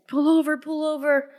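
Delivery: high, urgent cry to pull over; frantic high yell from the passenger seat; retold yell. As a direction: shout it at the driver in a panic. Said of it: "I'm yelling at Jason".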